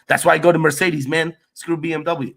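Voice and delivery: loud, fast, joking rant; mock outrage. Mock outrage, then just kidding.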